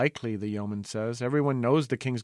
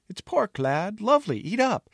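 cheery coaxing; 10 semitones higher, 4 dB louder than usual. Acted. Jester cheering Taster.

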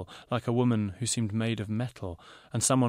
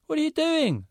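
much higher, louder, outraged; indignant shout; 6 dB louder than usual. A narrator's reading. Zana confronts the designer, indignant shout. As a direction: shout at him for chasing him. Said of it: "Zana shouted".

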